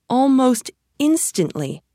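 plain reading voice

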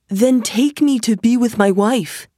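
pleading. A narrator's reading. Aladdin asks genie to reach his wife, pleading.

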